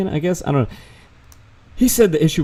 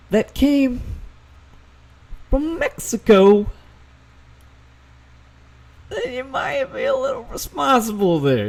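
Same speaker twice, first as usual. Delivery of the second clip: much higher and slow, teasing every word; sarcastic mock-sweet high voice; 11 semitones higher, slower than usual. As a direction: squeak "tiny" way up like scolding a child. Sarcastic lecture aimed at Mexico.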